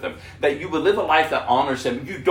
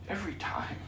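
soft breathy whisper of awe; barely voiced, full of wonder; 7 semitones higher, 11 dB quieter, faster than usual. Real, not acted. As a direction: let it fall to a hushed whisper. Awed aside after God forgives.

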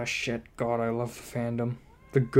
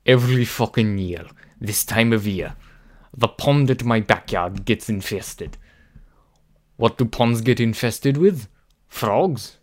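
a touch of a hammed-up Russian accent; loud, badly faked Russian accent; 7 dB louder than usual. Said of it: "do a really bad Russian accent".

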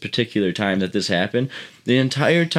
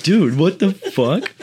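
incredulous; 8 semitones higher, slower than usual. Retold or imitated. Mocking reply to Roy.

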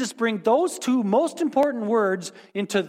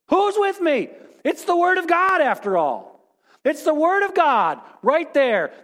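sarcastic zeal; 4 semitones higher than usual. Pretend. Mock crusade against money.

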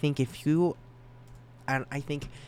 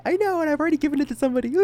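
giddy burst; 6 dB louder, much faster than usual. Real, not acted. Gushing confession about the new card.

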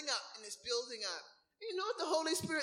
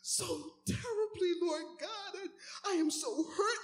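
high, tearful, put-upon whining to the Lord; a hurt believer whining to God about a sister, mock-tearful; whiny complaining prayer; 5 semitones higher than usual. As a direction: snivel out your grievance like a wounded child. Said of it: "always sniveling and crying".

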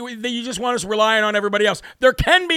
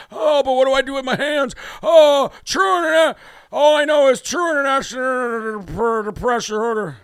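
raised, mock bumbling old man; imitating Biden; 3 semitones higher than usual. Mocking the president fumbling a speech. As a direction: stumble over the words in a hammed-up ramble. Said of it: "He was just like this".

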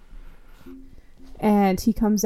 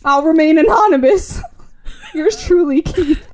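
louder mocking read, breaking into laughter; laughing mockery; 6 semitones higher, 6 dB louder than usual. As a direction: laugh through the sign-off as you mock it. Mocking the letter's sign-off.

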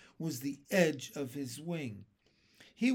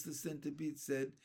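ordinary delivery

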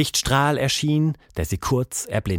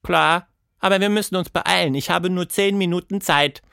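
put-on higher voice of a girl character; hurried young heroine; 8 semitones higher than usual. Girl tells monster time is short, higher and hurried. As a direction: pitch it up, urgent to get going.

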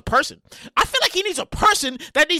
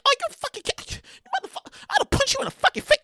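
higher, incredulous threat; high exasperated outburst; 12 semitones higher than usual. Pretend. Host self threatening writer alter ego, high. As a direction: blow up at yourself. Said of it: "I'm like".

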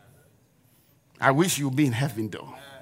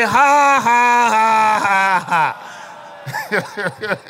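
loud, high mock guffaw running down into chuckles; hard forced laugh; 7 semitones higher, 6 dB louder, much slower than usual. Shown as a demonstration. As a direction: laugh really hard on purpose. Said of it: "now you start laughing really hard".

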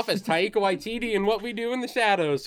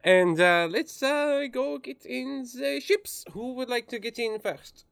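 higher, louder hammy foreign teacher voice; bad Peter Sellers accent; 4 semitones higher, 3 dB louder than usual. Instructor rallies the students to the ships in a high, hammy foreign accent. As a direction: ham up the instructor's cheerful accent. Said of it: "my bad impersonation of Peter Sellers".

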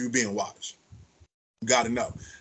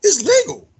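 higher, louder stress on legal; excited emphasis; 8 dB louder, slower than usual. Real, not acted. Telling a friend about Detroit laws, excitedly. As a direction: hit it like big news.

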